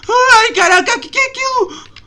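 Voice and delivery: high, loud startled exclamation; startled cry. Real, not acted. Gamer startled by a monster in the forest. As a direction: shriek out the surprise. Spots something scary.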